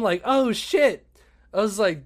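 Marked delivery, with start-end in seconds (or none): 0.2-1.1 surprised. Retold or imitated. Sees Dave Chappelle arrive.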